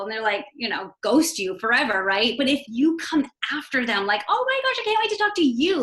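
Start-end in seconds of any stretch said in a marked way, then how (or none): 4.3-5.8 bright, much higher, gushing and quick; an eager coach thrilled to talk to a new prospect; gushing excitement. Retold or imitated. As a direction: squeal with delight at meeting them. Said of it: "Like, you make it all about them".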